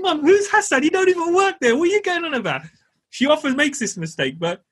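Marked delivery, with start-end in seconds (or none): 0.0-2.6 very high, incredulous retold protest; exasperated disbelief. Son asks mum who Hassan is, exasperated disbelief. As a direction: squeal it in baffled exasperation at mum.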